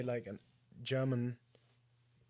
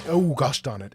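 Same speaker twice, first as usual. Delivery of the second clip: annoyed outburst; 12 dB louder than usual. Real, not acted. Stream stop annoys him.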